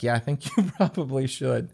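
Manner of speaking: brother-in-law laughing along; laughing agreement. Retold or imitated. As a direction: agree while chuckling. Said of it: "And he was like".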